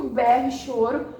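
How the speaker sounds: ordinary talk, nothing marked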